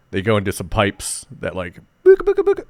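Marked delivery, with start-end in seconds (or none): 2.0-2.6 high-pitched booka booka noise; silly warp sound. Retold or imitated. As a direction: make a goofy warp noise. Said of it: "pipe travel sound".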